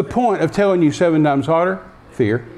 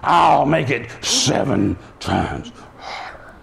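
low slow threat; 4 semitones lower, 3 dB quieter, slower than usual. Retold or imitated. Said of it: "We're still not gonna do it".

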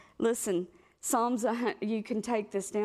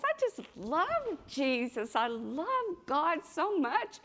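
gushing; 7 semitones higher, slower than usual. Retold or imitated. Retelling her own gushing to God.

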